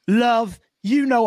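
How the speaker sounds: high-pitched mock British accent. Retelling the janitor's excuse in a British accent. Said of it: "he's got a British accent".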